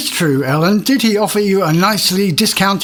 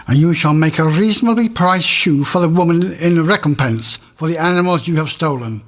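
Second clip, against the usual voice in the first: ordinary talk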